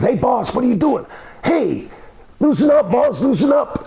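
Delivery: high-pitched mock panic; high, insistent retold cry to be released; alarmed protest. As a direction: imitate a trapped man pleading, pitch jumping up. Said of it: "He says".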